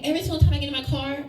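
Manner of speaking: ordinary delivery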